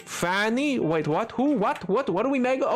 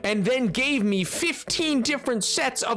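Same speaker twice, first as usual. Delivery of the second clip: slow drawn-out silly voice; slow goofy voice; 3 semitones higher, slower than usual. Pretend. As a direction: stretch it out slowly. Slow goofy rant about gecko.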